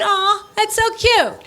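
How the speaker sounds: high, drawn-out mocking aww; mock sympathy. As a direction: coo with fake pity, high and slow. Mock sympathy at daughter's complaint.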